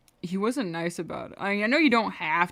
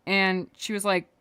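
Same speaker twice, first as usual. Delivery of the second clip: usual speaking voice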